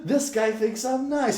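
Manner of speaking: loud, high, drawn-out mock yell; yelling guard. Guard yelling in the face of a cuffed man, mocking. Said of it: "yelling in my face and shit".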